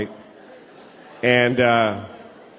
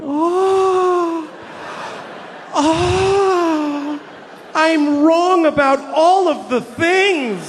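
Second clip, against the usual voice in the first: high, slow wailing remorse; hammed-up tearful admission of being wrong; mock anguish; 12 semitones higher, much slower than usual. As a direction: moan like you've been utterly defeated. Fantasy of recipient reading email.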